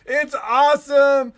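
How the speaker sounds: high, loud shouting delight; loud screaming joy; thrill-ride whoop. Pretend. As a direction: yell it from the first drop. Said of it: "you're like".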